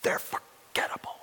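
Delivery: unvoiced, hushed whisper. Real, not acted. Pastor hushes the crowd on worldly greatness, whispering. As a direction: let it fall to a whisper. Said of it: "whispered preaching point".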